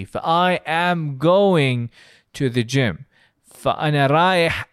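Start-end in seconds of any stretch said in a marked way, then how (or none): 0.1-1.9 going stretched very slowly and stressed. Shown as a demonstration. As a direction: drag out going to show the ing. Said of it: "حاطين معها I N G". (تشديد على going ببطء شديد)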